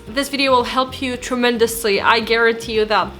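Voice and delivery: ordinary delivery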